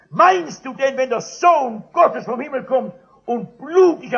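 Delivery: loud, high, urgent preaching; fervent preaching. Acted. City missionary preaching loudly to the drunkard about release from sin. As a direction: press the message home with force.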